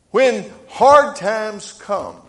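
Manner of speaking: slow, dramatic pulpit emphasis; preaching. Acted. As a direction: stretch out each word from the pulpit. Preaching at full force.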